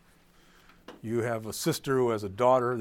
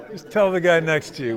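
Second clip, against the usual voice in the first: louder, words broken by laughter; laughing while talking; 4 semitones higher, 10 dB louder than usual. Real, not acted. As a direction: push through the giggle.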